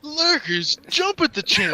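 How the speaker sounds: loud, slow, high voice breaking into laughter; clickbait video narrator. Pretend. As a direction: hype it up, laughing through it. Parodying clickbait video titles.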